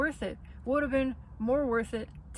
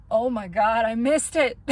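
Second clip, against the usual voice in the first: much louder burst of dismay; shocked realization; 10 dB louder than usual. Pretend. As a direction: blurt it out as the loss hits you. Sudden realization in the night.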